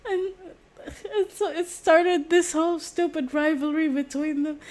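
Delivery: can barely keep it together; laughing. Real, not acted. Vlogger cracking up while explaining the skaters' rivalry. Giggling through the retelling.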